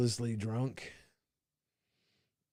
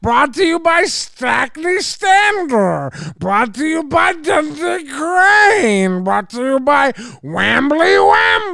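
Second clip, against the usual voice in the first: mock announcer; 12 semitones higher, 14 dB louder than usual. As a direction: ham up the sponsor plugs, shouting. Mock sponsor announcements, escalating.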